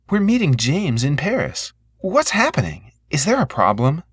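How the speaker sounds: anxious woman asking what's wrong; anxious sister. A narrator's reading.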